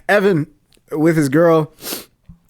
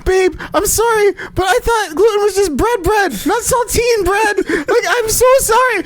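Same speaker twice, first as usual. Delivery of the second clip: put-on apologetic girlfriend; 13 semitones higher than usual. Host voicing the girlfriend apologizing at his hospital bedside, mock wail. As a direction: wail the sorry in a hammed-up way. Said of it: "her saying, like".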